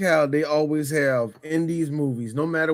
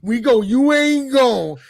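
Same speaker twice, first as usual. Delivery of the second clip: drawn-out, raised-pitch storyteller's hook; 4 semitones higher, 4 dB louder, slower than usual. Acted. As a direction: stretch it out, louder and slower.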